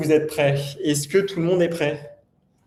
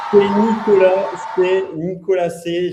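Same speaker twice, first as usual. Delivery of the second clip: hyped giveaway announcement; 4 semitones higher, 5 dB louder than usual. Acted. Annonce du second gagnant.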